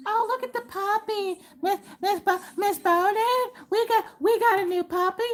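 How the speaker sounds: put-on high squeaky little boy showing off puppy; little boy bragging about his puppy to the teacher; excited kid. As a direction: gush over the puppy like a small boy. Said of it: "Him and his mama talking about they new dog".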